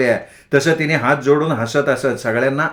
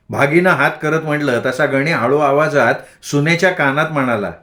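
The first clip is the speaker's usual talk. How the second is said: usual speaking voice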